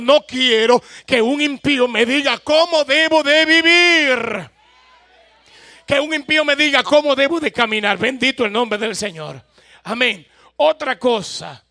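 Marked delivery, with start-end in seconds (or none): 0.0-8.0 loud, higher, firm declaration; declare it aloud. Shown as a demonstration. Telling the person beside you, loud firm declaration. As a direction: proclaim it to your neighbour with conviction. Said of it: "Dígale que está a su lado".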